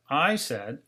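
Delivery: ordinary delivery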